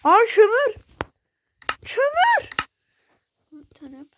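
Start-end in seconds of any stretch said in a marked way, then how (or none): 0.0-0.8 loud, high cry of delight at finding coal; excited shout. Real, not acted. Sudden find of coal in game. 1.9-2.5 much higher, loud rising squeal; excited squeal. Real, not acted. Repeats coal find, higher.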